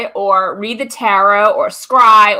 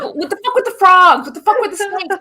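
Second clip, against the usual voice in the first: mock outraged friend; 8 semitones higher than usual. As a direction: play the friend freaking out, pitched up. Friend's retold outburst.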